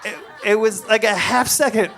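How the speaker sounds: plain reading voice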